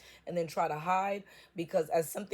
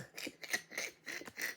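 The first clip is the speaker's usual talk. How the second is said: airy giggle; breathy laugh; 11 dB quieter than usual. Real, not acted. Reader laughing at her padded room joke.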